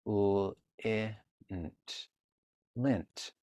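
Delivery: segmenting "lint" into L, I, nt, then blending; sounding out lint. Shown as a demonstration. Teacher modeling how to sound out "lint" for a child. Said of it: "A few more".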